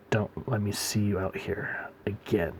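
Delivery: hushed, understated menace; quiet warning. Retold or imitated. Joker letting someone go with quiet warning.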